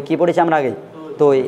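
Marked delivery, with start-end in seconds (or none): 1.2-1.4 তৈ with an "oi" glide at the end; old faulty pronunciation. Shown as a demonstration. Teacher telling students what to avoid, old faulty pronunciation. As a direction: say তৈ as "toi", not a pure round vowel. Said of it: "এই উচ্চারণটাও কিন্তু".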